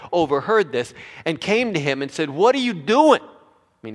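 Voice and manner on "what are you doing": loud, high mock outcry; wife's alarmed outburst. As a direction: imitate a wife confronting her husband, no accent. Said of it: "he didn't say it like that".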